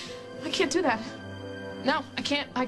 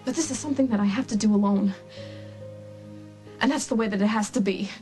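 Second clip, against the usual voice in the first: very low, weighty and final; sombre resolve; 11 semitones lower than usual. Acted. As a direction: state it low, as a painful decision. Breaks away from partner, resolved.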